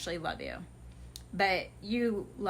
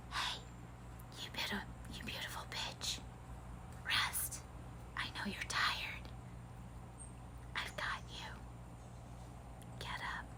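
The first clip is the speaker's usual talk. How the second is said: soft breathy whisper, much softer; universe whispering; 15 dB quieter than usual. Pretend. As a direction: whisper it like a gentle voice from the void. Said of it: "the universe will come in and they'll be like".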